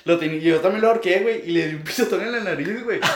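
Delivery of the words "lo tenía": ordinary delivery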